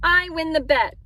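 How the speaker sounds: high, loud, gleeful boast; happy cry. A narrator's reading. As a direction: crow happily over winning. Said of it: "Ben cried happily".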